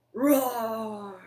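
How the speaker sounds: put-on playful roar for the children; lion roar. Animal from the hat.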